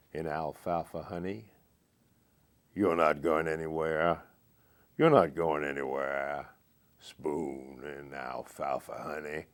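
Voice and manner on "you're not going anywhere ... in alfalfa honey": Morgan Freeman voice. Shown as a demonstration. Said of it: "I'll just repeat the last stanza in his voice".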